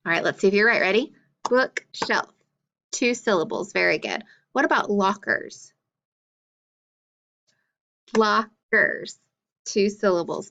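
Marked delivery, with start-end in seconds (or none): 1.5-2.3 "Bookshelf" split into Book, shelf; syllable by syllable. Shown as a demonstration. Teacher checking pupils' clapping, "Bookshelf" syllable by syllable. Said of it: "clap for each syllable that you hear". 8.2-9.2 "Lockers" split into Lock, ers; syllable by syllable. Shown as a demonstration. Teacher counting syllables for pupils, "Lockers" syllable by syllable. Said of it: "clap for each syllable that you hear".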